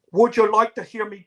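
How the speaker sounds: put-on British accent, pitched high and loud; badly faked British accent, high and showy; mock British accent. Girl showing off her British accent, high mock British. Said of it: "I can do a British accent".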